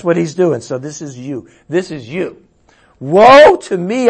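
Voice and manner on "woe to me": loud, high, drawn-out cry of woe; anguished cry. A narrator's reading. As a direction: wail it out slowly and loudly. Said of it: "I cried".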